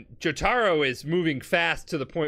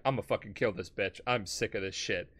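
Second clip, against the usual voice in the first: low menacing threat; 5 semitones lower, 7 dB quieter than usual. Retold or imitated. Jotaro furious at Dio.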